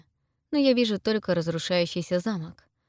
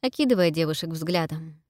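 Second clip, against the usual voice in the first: plain reading voice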